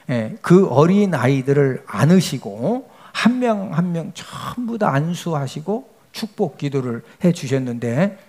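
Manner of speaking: ordinary talk, nothing marked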